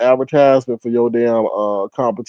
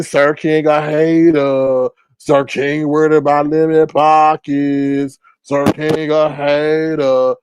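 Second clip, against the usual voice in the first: slow, drawn-out mocking chant; mocking taunt; slower than usual. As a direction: stretch out every word to mimic the haters. Mocking what everybody says about him.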